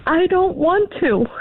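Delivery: put-on high, slow small-boy plea; imitating tearful child. Mother retelling her son at school, imitating tearful child. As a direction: lightly voice a child close to tears. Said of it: "he slightly begins to cry".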